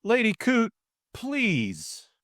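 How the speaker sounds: high-pitched plea; exasperated appeal. A narrator's reading. George urging Lady Coote to leave, exasperated. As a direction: plead high, easing off on the last word.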